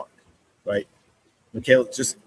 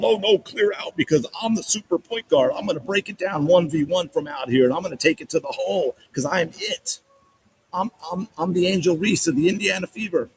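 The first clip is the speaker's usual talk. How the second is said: higher-pitched mock bragging; hammed-up bravado in a higher voice; mocking a cocky player; 7 semitones higher than usual. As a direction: play a self-important point guard, pitched up.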